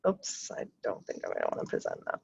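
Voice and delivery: oops, whispered under her breath to herself; a quick unvoiced aside, not meant for the class. Real, not acted. Teacher whispering to herself while sharing her screen.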